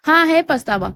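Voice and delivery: ordinary delivery, nothing marked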